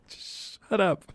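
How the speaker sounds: fed up. Retold or imitated. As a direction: dismiss the raving actor under strain. Fed-up reply to retold actor.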